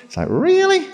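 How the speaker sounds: high mock disbelief; incredulous. Real, not acted. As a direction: scoff at the ingratitude. Exasperated disbelief.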